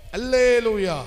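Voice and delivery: rallying call to the congregation; loud hallelujah. Shown as a demonstration. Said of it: "சத்தமா சொல்லுங்களேன்". (சத்தமாக அல்லேலூயா முழக்கம்)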